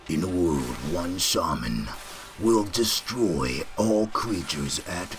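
put-on movie narration, slowing down; drawn-out trailer-style narrator. Shown as a demonstration. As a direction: do an epic film trailer voice. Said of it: "I'm gonna start talking in my movie voice".

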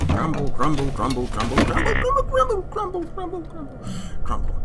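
comic muttered complaint that climbs in pitch; mock grumbling. Mock grumbling after a threat.

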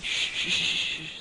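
airy unvoiced shushing. Player shushing a creeper, unvoiced hushes. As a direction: hush the creeper, all breath.

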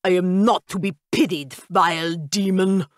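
indignant vampire. A narrator's reading. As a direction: hurl it like a wounded count's grand exit.

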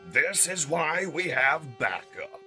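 loud, slow, high-pitched declaration; heroic leader. Acted. Optimus reveals his reinforcements, triumphant. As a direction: announce the reinforcements grandly. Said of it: "Optimus finishes his statement".

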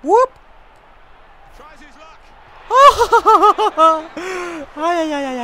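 high loud whoop breaking into laughter. Real, not acted. Gamer whooping and laughing after scoring. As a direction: celebrate with a whoop. Celebrating a goal.

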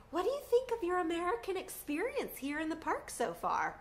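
high, chirpy, lightly voiced mom; cheery hostess mom. Mom chatting with a Japanese family at the campfire, in a lightly voiced high cheery tone. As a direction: ask it like a sunny tour guide. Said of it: "she's like".

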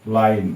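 লাইন with long "eye" vowel, ending on n; silent final e. Shown as a demonstration. Teacher demonstrating the rule for learners, লাইন clearly pronounced with silent e. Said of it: "ই উচ্চারণ হবে না".

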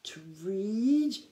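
wheezing, scandalised whisper; choked wheeze. A narrator's reading. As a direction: choke out a horrified question. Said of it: "faint wheezing noise of Gerda trying not to".

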